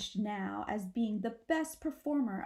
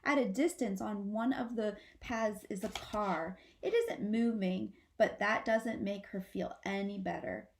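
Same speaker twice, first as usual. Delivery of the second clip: usual speaking voice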